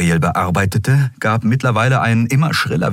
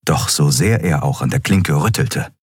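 ordinary delivery

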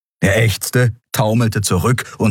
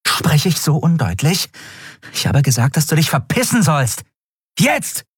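much higher, cutting warning; higher, menacing order; angry threat; 8 semitones higher than usual. A narrator's reading. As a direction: make him back off now. Woman threatens dealer by collar.

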